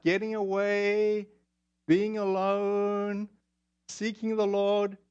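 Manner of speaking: slow, drawn-out dreamy phrases; dreamy, idyllic. Acted. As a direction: linger on each phrase like a retreat brochure. Idyllic appeal of retreats, dreamily listed.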